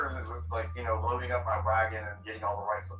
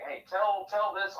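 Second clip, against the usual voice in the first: mocking plea; 10 semitones higher, 3 dB louder, slower than usual.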